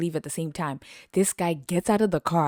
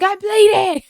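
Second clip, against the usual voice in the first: much higher, louder complaint; whiny I'm bleeding; 7 dB louder than usual. Retold or imitated. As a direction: wail it in mock distress. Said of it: "And I was like".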